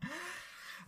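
high, hammed-up evil cackle; villain laugh. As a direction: cackle like a gleeful madman. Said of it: "began to laugh loudly".

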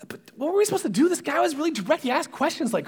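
high-pitched mock protest; defensive sons. Sons excusing themselves to Jacob, defensive. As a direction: argue back, voice swooping up.

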